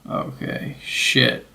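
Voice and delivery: very soft, breath-only mutter to oneself; muttered dismay. Retold or imitated. Traveller's private reaction to being questioned, muttered dismay. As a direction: say it under your breath.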